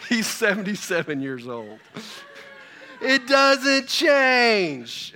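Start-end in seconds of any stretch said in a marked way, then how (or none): 3.0-5.1 loud, high, very drawn-out declaration; raised voice, slow and emphatic; emphatic punchline. Real, not acted. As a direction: stretch every word out for the congregation. Preacher drives home the story's point.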